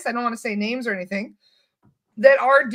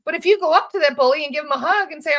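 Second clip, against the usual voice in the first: ordinary talk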